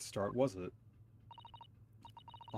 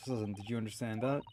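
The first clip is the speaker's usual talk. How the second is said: ordinary delivery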